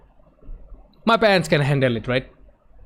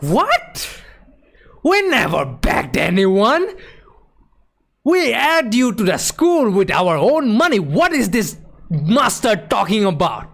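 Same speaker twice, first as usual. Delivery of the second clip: furious parents; 5 semitones higher than usual. Retold or imitated. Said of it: "my parents are furious".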